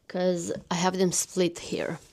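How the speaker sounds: quick whispered aside; whispering. Real, not acted. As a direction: whisper it to yourself. Muttered aside while sorting books.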